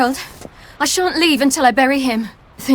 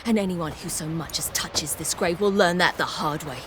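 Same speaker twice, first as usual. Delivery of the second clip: low, soft menacing warning; quiet threat; 6 semitones lower, 10 dB quieter than usual. Acted. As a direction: threaten them softly, deadly serious. Threatening villagers at the grave.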